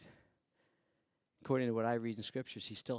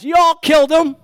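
much louder, higher accusing shout; Peter's shout; 26 dB louder than usual. Acted. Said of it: "Peter said on the day of Pentecost".

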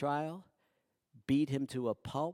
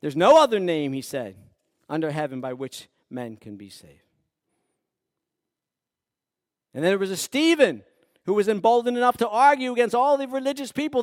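loud, higher, rising preacher's voice; preaching at full force; 12 semitones higher, 16 dB louder than usual. Acted. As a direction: build it up like a revival sermon. Preaching Stephen's story.

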